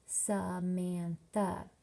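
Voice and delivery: "Samantha" drawn out slowly, syllable by syllable. Shown as a demonstration. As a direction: stretch "Samantha" into three beats. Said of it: "three syllable words".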